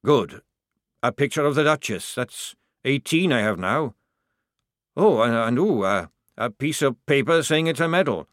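sergeant in shock. A narrator's reading. Sergeant going through his discharge packet, stunned. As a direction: list the contents as if putting on a brave face.

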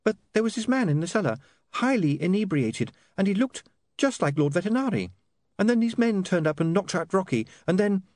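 tumbling out her story; young woman rushing through her excuse; Saccharissa's voice. A narrator's reading.

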